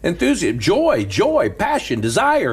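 building sermon cadence; preaching. Acted. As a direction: preach it up with fire.

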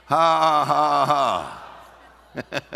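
loud, deliberate ha-ha-ha; demonstrative laugh. Shown as a demonstration. Preacher laughing in the face of a problem, forced laugh. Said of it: "Look your problem in the face and laugh".

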